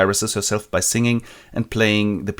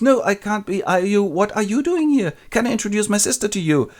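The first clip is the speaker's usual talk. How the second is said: high, rushed flustered babble; flustered Darcy; 11 semitones higher than usual. Retold or imitated. Darcy flustered meeting Lizzie, high and rushed. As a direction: stammer in embarrassment. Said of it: "And he goes".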